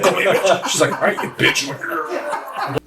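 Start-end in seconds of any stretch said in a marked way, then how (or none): 0.0-0.7 insistent request. Retold or imitated. Retold request while lifting. 0.9-2.8 much higher, slow, laughing "all right, you bitch"; mock wife insult. Host retelling his wife finally insulting him, high giggly voice.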